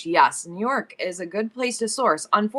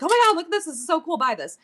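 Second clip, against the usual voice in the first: gushing excitement; 9 semitones higher, faster than usual. Retold or imitated. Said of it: "I'm like".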